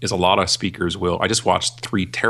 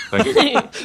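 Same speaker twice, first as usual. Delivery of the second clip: much higher, slow, laughing sarcastic thanks; laughing mock thanks; 3 dB louder than usual. Real, not acted. Guest joking about being furious, laughing thanks. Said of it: "I'm furious".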